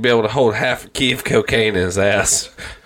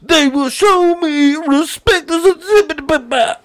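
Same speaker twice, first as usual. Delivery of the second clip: Bill Cosby impression; 13 semitones higher than usual. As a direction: stretch it out like a cartoonish Cosby. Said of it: "still trying to act like Cosby".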